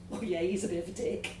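ordinary delivery

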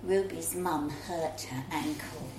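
ordinary delivery

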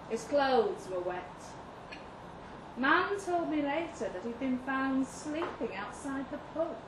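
plain reading voice

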